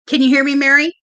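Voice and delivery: ordinary delivery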